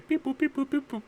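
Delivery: high-pitched mouth-made computer beeps; electronic beeping. Pretend. Player typing on a communicator, high playful beeping. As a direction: chirp out little machine button noises.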